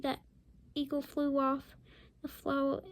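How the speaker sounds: ordinary delivery, nothing marked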